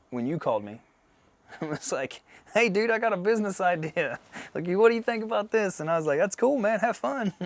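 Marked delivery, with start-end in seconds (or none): 2.5-5.8 high, loud imitation of an excited friend; hammed-up enthusiastic proposal; eager pitch. Acted phone pitch from friend. 6.2-7.3 high, louder mock-cheery dismissal; retold sing-out polite refusal; breezy brush-off. Acted brush-off of friend's pitch.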